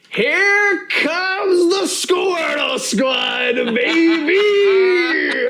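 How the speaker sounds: very high, drawn-out showman's cry; hammed-up title shout. Host announces episode title, hammed-up high shout. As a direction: stretch every word into a huge announcer holler.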